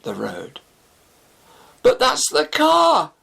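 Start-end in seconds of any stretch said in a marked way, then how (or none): 1.8-3.1 a high, loud, slow cry of disbelief; shocked shout. Acted. Shafi seeing his stolen car speed by, shouting. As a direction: shout it as the car roars past. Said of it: "Shafi exclaimed".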